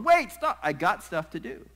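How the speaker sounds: high, loud, urgent objection; hammed-up protest. Jesus pulling away from clinging Mary, loud high mock protest. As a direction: protest as if trying to break free. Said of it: "the tone of it as you look at it in".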